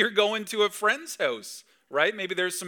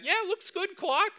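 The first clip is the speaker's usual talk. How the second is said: very high-pitched put-on voice; film family member. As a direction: squeak out mock approval to Clark.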